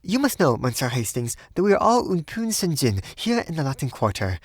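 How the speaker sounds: high, voluble woman's voice, rushing in places; nervous high chatter that falters at the end; almost feverish. A narrator's reading. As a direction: talk on feverishly, pitched high. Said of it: "began talking almost feverishly".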